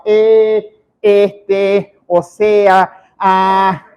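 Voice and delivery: each filler held long on one level note; stalling filler words. Shown as a demonstration. Coach mimics speaker afraid to stay silent, drawn-out stalling fillers. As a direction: drag out every hesitation sound. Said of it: "¿qué hago entonces?".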